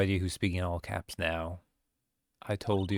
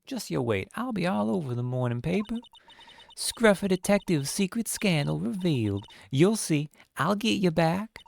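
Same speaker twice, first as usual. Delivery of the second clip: a pushy southern gal, pitched up; southern accent; 11 semitones higher than usual. Acted. Lotta threatening the detective in a southern accent. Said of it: "That southern accent can only mean".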